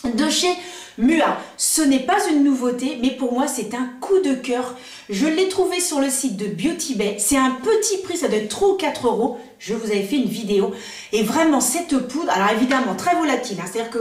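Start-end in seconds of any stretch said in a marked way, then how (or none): none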